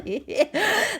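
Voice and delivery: high giggle. Acted. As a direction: giggle happily. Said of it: "he began to giggle".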